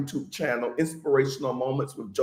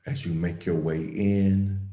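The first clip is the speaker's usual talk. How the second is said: guided meditation; 6 semitones lower than usual. Real, not acted. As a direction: ease listeners gently into calm, unhurried and even.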